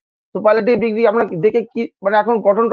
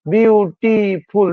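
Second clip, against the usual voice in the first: Beautiful very slowly in three separate beats; syllable by syllable; 2 semitones higher, much slower than usual. Shown as a demonstration. Teacher counting syllables of beautiful for students, drawn out beat by beat. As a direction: sound out বিউ, টি, ফুল, one at a time. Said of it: "দেখো তিনবার হচ্ছে".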